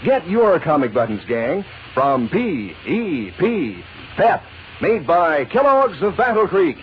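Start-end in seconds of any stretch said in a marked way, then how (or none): none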